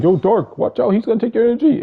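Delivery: high, loud, fast panicked call-out; yelling at Dark to watch out; urgent warning yell. Retold or imitated. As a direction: shout a warning to your friend mid-fight. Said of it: "I was immediately like".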